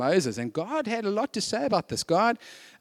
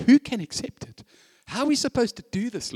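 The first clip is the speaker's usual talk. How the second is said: disciples grumbling; 6 semitones higher than usual. Acted. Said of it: "his disciples were grumbling".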